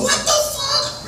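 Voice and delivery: high, slow what the fuck; stunned disbelief. Retold or imitated. Reading the news in Rikers, high disbelief. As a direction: stretch it out, baffled.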